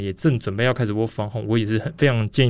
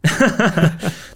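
quick amused laugh; a brief real laugh; short laugh; 7 semitones higher than usual. Real, not acted. Laughs at partner's breathlessness.

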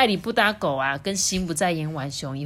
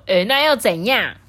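defiant unicorn; 3 semitones higher, 6 dB louder than usual. A narrator's reading. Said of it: "小不角兽甚至会说".

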